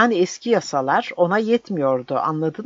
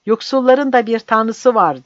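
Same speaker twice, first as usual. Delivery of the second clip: higher, loud yell; shouting; 6 semitones higher, 6 dB louder than usual. A narrator's reading. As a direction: shout it in his ear. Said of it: "diye bağırdı". (kulağına bağırarak)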